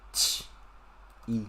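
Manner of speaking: 起 broken into a q sound, then a long i; separated sounds. Shown as a demonstration. Teacher breaking down pinyin, 起 in separated sounds. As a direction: say 起 in two pieces, consonant then vowel.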